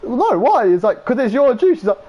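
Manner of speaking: high, loud, whiny protests; squeaky indignant friend. Retold or imitated. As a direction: play the outraged teen. Retold squeaky back-and-forth.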